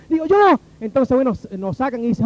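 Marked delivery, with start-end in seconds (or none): 0.3-0.6 high, loud, eager reply; calling out in answer. Retold or imitated. Detainee answers when his name is called, calling out. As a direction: shout it back to be counted.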